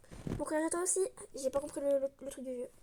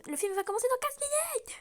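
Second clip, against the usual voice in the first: line pitched up, pressing; higher, impatient retake; 9 semitones higher than usual. Acted. Girl reminding Max the film starts soon, higher and pressing. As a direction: push it higher and urgent, like she's tired of waiting.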